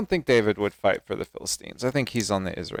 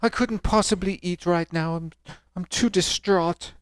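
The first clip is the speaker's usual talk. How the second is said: high, hammed-up distraught refusal; mock dramatic; 8 semitones higher, 3 dB louder than usual. Reader mocks Saul refusing supper, melodramatic high voice. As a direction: decline food with overblown, put-on anguish. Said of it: "people are dramatic like that".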